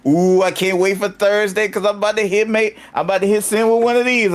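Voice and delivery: high-pitched, giddy can't-wait voice; replaying giddy excitement; acted eager anticipation. Acts own excitement on seeing sign.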